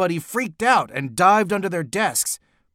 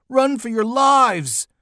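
high, loud, drawn-out warning; panicked high shout; yelled; 7 semitones higher, 5 dB louder, slower than usual. A narrator's reading. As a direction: yell for everyone to flee, stretching it. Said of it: "Neil yelled".

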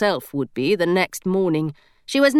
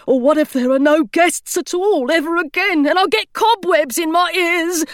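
a gargoyle panicking about being left alone; fretful gargoyle; 8 semitones higher than usual. A narrator's reading. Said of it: "the gargoyle sighed".

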